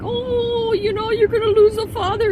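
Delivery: exaggerated imitation of a tearful complainer; mock crying. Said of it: "to be crying like".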